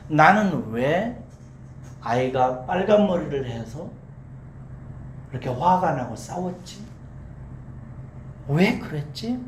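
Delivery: slow, halting questions with long pauses; self-questioning. Acted. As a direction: puzzle it out phrase by phrase. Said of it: "다시 물은 거예요".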